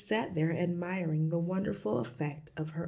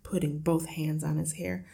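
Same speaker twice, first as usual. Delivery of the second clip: usual speaking voice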